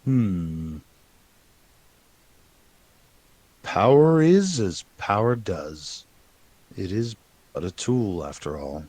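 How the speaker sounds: slow, fading oracle voice; mysterious spirit. Acted. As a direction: speak like a cryptic spirit.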